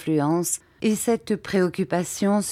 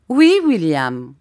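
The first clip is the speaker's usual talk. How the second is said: drawn-out, louder, lordly yes; a slow, patronizing reply; condescending; 7 dB louder, slower than usual. A narrator's reading. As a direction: answer slowly, talking down to an inferior. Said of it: "dis-je d'un ton de condescendance".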